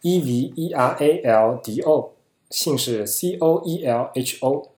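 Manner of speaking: slow, letter-by-letter spelling. Shown as a demonstration. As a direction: spell each letter out slowly and clearly. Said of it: "那他的名字拼法是".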